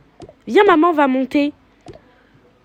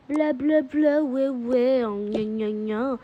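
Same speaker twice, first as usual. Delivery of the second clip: mocking mumble; 3 semitones lower, 7 dB quieter, much slower than usual. Mocking mum's scolding.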